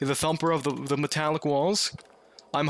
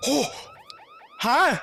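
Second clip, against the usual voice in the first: shouting in real fright; frightened shout; slower than usual. Real, not acted. Attacked by mannequin.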